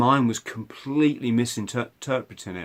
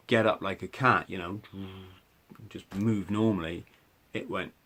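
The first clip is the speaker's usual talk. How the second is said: usual speaking voice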